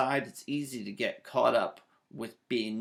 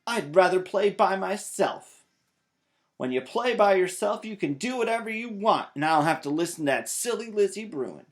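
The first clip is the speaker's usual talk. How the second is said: huffy rant; 6 semitones higher, 7 dB louder than usual. A narrator's reading. Sulking child rants to Mama.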